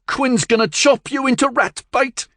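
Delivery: defiant hiss. Acted. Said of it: "Billy Joe hissed in defiance".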